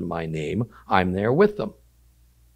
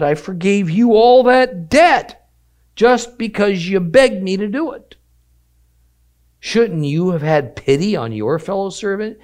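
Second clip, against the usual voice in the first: angry master; 10 semitones higher, 7 dB louder than usual. Acted. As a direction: scold the wicked servant in outrage. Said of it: "And in anger".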